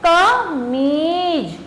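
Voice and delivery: drawn-out, syllable by syllable; slow model reading. Shown as a demonstration. Teacher naming the picture slowly for pupils. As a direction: stretch the word out slowly for the class. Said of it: "पहला चित्र है".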